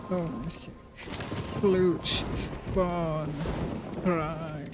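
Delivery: low, subdued word-list voice; softened recital, word by word; put-on reading voice. Real, not acted. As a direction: recite single words in an odd, sinking put-on voice. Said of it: "I don't know why I'm using this voice".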